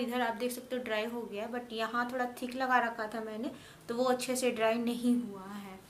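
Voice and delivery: talking through stiff drying mask; speaking with face mask on. Real, not acted. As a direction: lips moving under facial paste.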